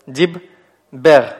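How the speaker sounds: syllable-by-syllable "Диб... бер"; split into syllables. Shown as a demonstration. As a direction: divide "Диббер" into two syllables, leaning on "бер". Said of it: "Мы видим два слога в этом слове".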